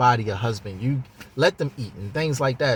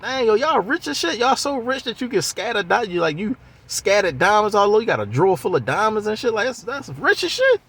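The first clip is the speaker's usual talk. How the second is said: pitched way up, loose slangy grown-man talk; streetwise grown man; 8 semitones higher than usual. Retold or imitated. Ray marvelling at the family's riches, high and slangy. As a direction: imitate a kid who talks like a grown man. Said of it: "that's what Ray is talking like".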